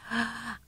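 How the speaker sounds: sharp, soft gasp of shock; startled gasp. Retold or imitated. Mom caught on her phone by the school lady, gasping. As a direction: gasp like you've been caught.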